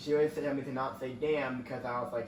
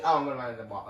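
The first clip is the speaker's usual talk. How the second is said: ordinary talk, nothing marked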